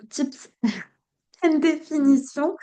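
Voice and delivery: English title read with a thick French accent, a laugh breaking in; accent anglais français. Real, not acted. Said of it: "mon accent pourri". (anglais lu avec un fort accent français, en riant)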